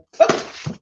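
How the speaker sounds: unvoiced embarrassed laugh; sheepish laugh at herself; breathy laugh. Real, not acted. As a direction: laugh it off under your breath. Caught fumbling on live.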